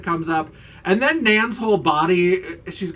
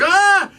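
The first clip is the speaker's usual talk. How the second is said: high, loud imitated scream; mock freak-out scream; 8 dB louder than usual. Imitating her freak-out at the water glass, mock scream. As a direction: let out a hammed-up shriek. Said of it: "So she freaks out".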